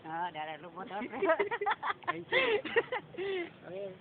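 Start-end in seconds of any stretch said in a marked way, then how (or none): 1.1-2.0 short high-pitched laugh; burst of laughter. Real, not acted. Roaster after own jab, high burst of laughter.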